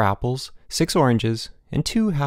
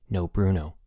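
ordinary delivery

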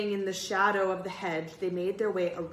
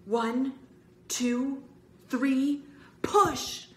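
building count; 7 semitones higher, 5 dB louder, much slower than usual. A narrator's reading. Boy leading a joint shove on doors, rising count to shout. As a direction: count building to a loud cry.

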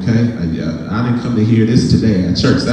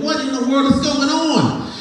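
mock disbelief; 11 semitones higher, 6 dB quieter than usual. Retold shock at getting covid.

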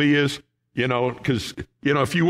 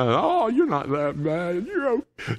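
mock wife's reply; 5 semitones higher than usual. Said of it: "some just kinda".

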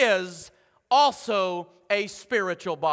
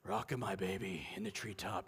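low, soft eerie lullaby; creepy lullaby; 16 dB quieter than usual. Shown as a demonstration. Pastor to congregation, creepy lullaby voice. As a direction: make a nursery rhyme sound haunted. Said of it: "just put a creepy voice to that whole thing".

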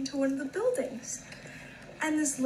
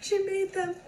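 laughing while telling; 6 semitones higher than usual. Real, not acted. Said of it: "and it's a smile".

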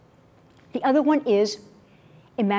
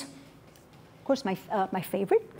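whispered aside; a quick whispered of course; 3 semitones lower, 12 dB quieter than usual. Real, not acted. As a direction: drop to a breathy whisper.

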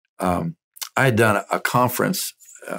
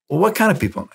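chatty seatmate; 9 semitones higher, faster than usual. Retold or imitated. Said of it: "who got real chatty".

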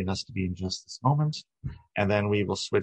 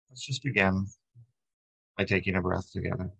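calm, even meditation guide with long pauses; unhurried body-scan voice, settling at phrase ends; guided meditation. Acted. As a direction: lead them gently through breath and body.

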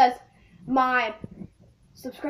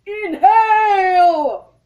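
drawn-out loud wail; disgusted shout; 3 semitones higher, 10 dB louder, much slower than usual. Real, not acted. Reacting to skunk spray taste.

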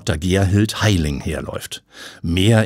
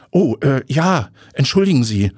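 startled stammer; 6 semitones higher, 3 dB louder than usual. Acted. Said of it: "stottert Tiffany und fährt erschrocken herum".

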